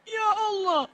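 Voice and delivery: high, soft wailing prayer; acted tearful plea. Preacher imitating the crowd's tearful prayer, soft high wail. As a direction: cry out softly, very high, as if weeping. Acting out a communal weeping prayer.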